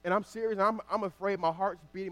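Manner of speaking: ordinary delivery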